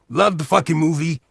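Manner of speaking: ordinary talk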